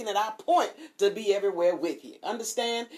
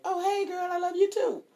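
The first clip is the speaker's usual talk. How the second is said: much higher, sing-song friendly greeting; sudden sweetness; 13 semitones higher than usual. Retold or imitated. Won-over woman greeting her cheerful friend, sudden high sweetness. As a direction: melt into delight. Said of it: "it was like".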